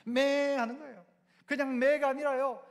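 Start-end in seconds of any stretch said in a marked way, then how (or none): 0.1-0.6 louder, higher mock bleat; sheep's bleat. Shown as a demonstration. Preacher has congregation copy the sheep, loud imitated bleat. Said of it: "양이 어떻게 우나요?".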